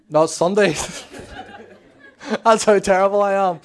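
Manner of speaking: loud, real laughter through the words; laughing at oneself. Real, not acted. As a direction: burst out laughing at your own mix-up. Laughs loudly at own mix-up.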